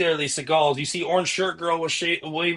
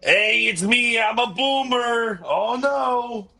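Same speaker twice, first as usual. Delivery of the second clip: higher, slow put-on old boomer voice; grumbling out of touch boomer; 7 semitones higher, much slower than usual. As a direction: play a clueless old boomer. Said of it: "your best boomer impression".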